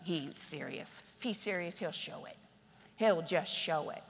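low, soft, half-whispered scoffing; dismissive mutter. Retold or imitated. Churchgoers dismissing the young man quietly. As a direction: shrug him off under your breath.

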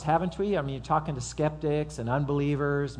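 ordinary talk, nothing marked

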